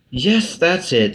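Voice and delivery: slow, high sighing reply; a slow sad admission. A narrator's reading. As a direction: sigh it out wearily. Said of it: "said the Hatter with a sigh".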